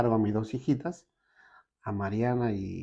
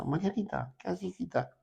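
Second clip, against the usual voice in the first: put-on higher, gently puzzled grandma asking the child; grandmother's coaxing voice; 9 semitones higher than usual. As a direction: coax the little girl like a worried grandmother. Grandmother asks the quiet child.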